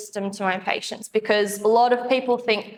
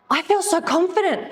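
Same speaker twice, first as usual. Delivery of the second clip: imitating patient; 8 semitones higher than usual. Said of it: "and he goes".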